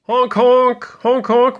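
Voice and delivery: high, loud honk-honk of busy cars; car horns honking. Retold or imitated. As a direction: honk like cars blaring at a duck. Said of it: "went the cars".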